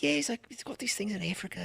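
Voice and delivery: put-on British accent. Comic retelling of neighbor Simon's covid advice, British accent. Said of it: "he's British".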